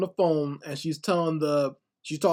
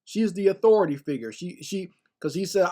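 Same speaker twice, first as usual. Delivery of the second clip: normal talking voice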